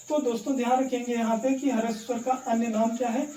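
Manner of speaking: usual speaking voice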